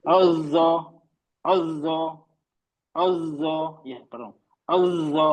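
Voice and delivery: अज़्ज़ा with the ज़्ज़ buzzing on; buzzing doubled z in अज़्ज़ा; voiced zz flow. Shown as a demonstration. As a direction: let the ज़ of अज़्ज़ा buzz steadily. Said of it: "sound flow हो रहा है".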